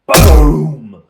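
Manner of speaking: loud shouted boom; booming impact. Acted. GM narrating the ogre's club strike, a loud boom. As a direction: belt out the club's impact.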